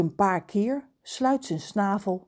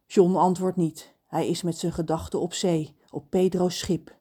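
plain reading voice

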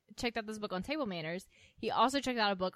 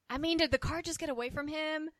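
high mock Charlene voice; 7 semitones higher, 13 dB louder than usual. Quoting Charlene, high put-on voice.

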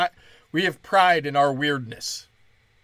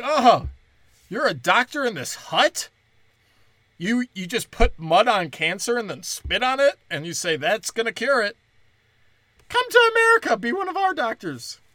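loud, high mocking sales pitch to a village doctor; hammed-up condescending invite, climbing higher; recruiting a village healer, loud mock welcome; mocking recruiter; 7 semitones higher, 4 dB louder than usual. Mocking quote to African doctor.

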